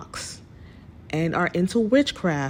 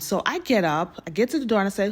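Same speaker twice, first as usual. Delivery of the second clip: usual speaking voice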